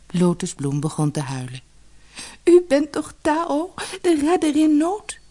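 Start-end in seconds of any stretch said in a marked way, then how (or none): 2.4-5.2 plaintive wail. Acted. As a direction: plead through tears, voice pitched high. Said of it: "klaagde ze".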